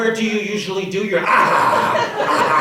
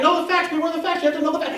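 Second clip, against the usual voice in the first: heated insistence; 11 semitones higher, faster than usual. Acted. Ranting on.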